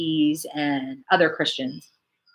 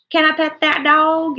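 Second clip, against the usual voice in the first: put-on child voice quote; 10 semitones higher, 5 dB louder than usual. Quotes a known TikTok sound.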